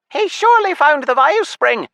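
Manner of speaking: high squeaking imp voice; squeaky imp voice. Acted. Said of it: "Tarbus the imp squeaked".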